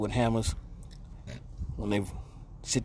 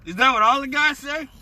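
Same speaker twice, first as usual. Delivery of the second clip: laughing; 13 semitones higher, 6 dB louder than usual. Real, not acted. Joking jab, then laughing.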